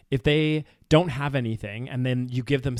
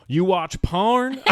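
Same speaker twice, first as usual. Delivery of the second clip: high, slow Southern drawl; Joe Exotic; 6 semitones higher, 4 dB louder, much slower than usual. Acted. Joe quizzing Travis about his viewing, drawn-out Southern twang. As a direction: stretch the question out with a Southern twang. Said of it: "your Joe Exotic, uh, impression".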